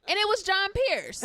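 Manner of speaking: high, loud excited announcement; excited reveal. Real, not acted. Guest revealing the singer's identity to the host, excited. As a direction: belt out the big reveal.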